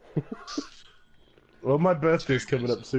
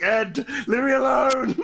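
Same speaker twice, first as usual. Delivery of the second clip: whiny plea; 9 semitones higher than usual. Retold or imitated. Exasperated plea to friend.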